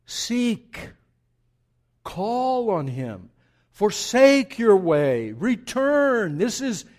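loud, high, urgent exhortation; ringing pulpit summons; full-force preaching. Real, not acted. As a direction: command the congregation with drawn-out imperatives.

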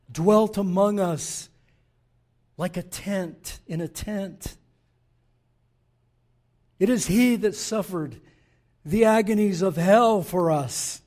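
loud, impassioned sermon read; preaching. Acted. Preacher reading the professor's quote to the church, loud. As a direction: proclaim it with full force.

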